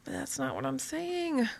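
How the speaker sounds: high, soft protesting whine; protesting mutter. Acted. Blogger answering commenters who misread, protesting mutter. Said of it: "force me to mutter".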